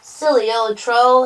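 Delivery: triumphant goat, lightly amused; chuckled. A narrator's reading. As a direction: say it with a touch of chuckle. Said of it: "he chuckled".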